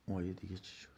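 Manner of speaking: very soft, low mutter to himself; muttered aside. Real, not acted. Preacher searching memory for the other verse, muttering low. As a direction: mutter it almost in a whisper while trying to remember.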